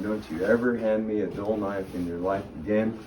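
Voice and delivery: ordinary talk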